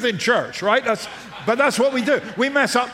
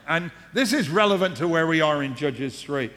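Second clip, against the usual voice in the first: ordinary delivery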